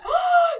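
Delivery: sharp, high gasp of delight; excited gasp. Retold or imitated. Spotting the Eiffel Tower from dinner: high, excited gasp. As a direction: gasp like you just saw it.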